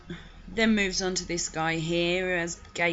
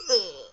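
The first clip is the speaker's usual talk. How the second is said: disgusted ugh; 5 dB louder than usual. Real, not acted. Disgusted reaction to the quote.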